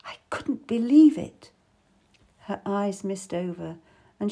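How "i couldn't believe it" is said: breathless, unvoiced whisper of shock; whispered disbelief. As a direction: let it out as a stunned whisper. Grieving woman recalls murder.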